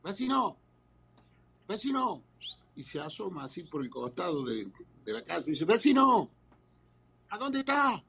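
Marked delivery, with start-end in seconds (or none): 0.0-2.2 high-pitched call into the distance. Acted. Husband searching outside for the neighbour, calling out high. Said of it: "salió a buscarlo el vecino y gritaba". 5.7-8.0 high, louder call for a missing man. Acted. Husband leaning round the side of the house, hollering.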